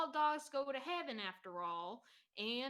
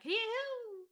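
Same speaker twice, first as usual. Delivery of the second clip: high loud giddy whoop; mock whoop; 12 semitones higher, 5 dB louder than usual. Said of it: "You were like".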